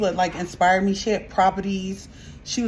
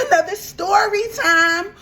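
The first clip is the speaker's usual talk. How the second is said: excited intro; 9 semitones higher, 4 dB louder, slower than usual. Real, not acted. Vlog intro, greeting viewers.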